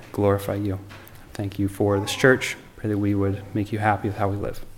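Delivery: soft, hushed closing prayer; quiet prayer. Real, not acted. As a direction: pray softly over the church.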